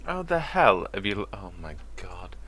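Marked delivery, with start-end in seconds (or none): 1.3-2.4 stunned breathy whisper of disbelief; astonished under-the-breath whisper. Real, not acted. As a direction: whisper it, amazed. Shocked at the other's find.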